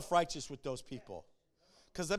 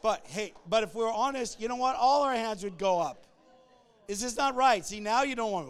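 raised, louder preacher's challenge; forceful preaching; 7 semitones higher, 10 dB louder than usual. Acted. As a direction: press the congregation to admit it. Preaching burst to congregation.

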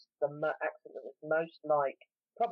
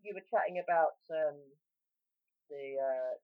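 ordinary delivery

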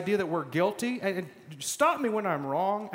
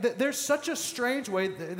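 ordinary talk

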